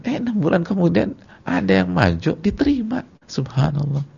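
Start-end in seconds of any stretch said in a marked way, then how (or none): none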